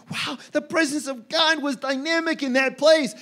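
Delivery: high, put-on rave; gushing enthusiasm. Churchgoers leaving a powerful service, gushing enthusiasm.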